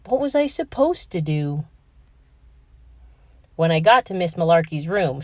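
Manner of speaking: ordinary delivery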